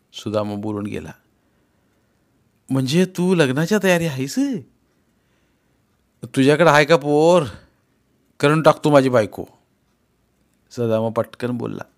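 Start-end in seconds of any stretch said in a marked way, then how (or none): 2.7-9.4 eager. Acted. Sudama eagerly proposes.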